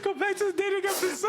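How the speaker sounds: high, laughing through the words; laughing tease. Real, not acted. Cohost laughing, telling host to drop the pizza rant. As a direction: giggle it out mockingly.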